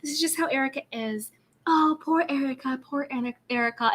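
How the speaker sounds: mock Rinna. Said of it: "Rinna is like".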